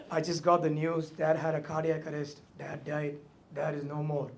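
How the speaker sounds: ordinary talk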